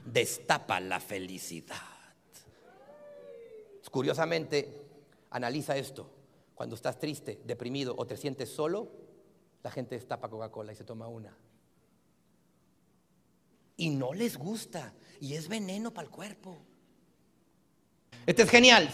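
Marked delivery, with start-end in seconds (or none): 0.0-1.8 much lower, slow, softer slogan; mock ad slogan. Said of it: "te ponen el anuncio". 3.9-16.6 much lower and softer, confiding; hushed confiding. Acted. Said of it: "hushed confiding".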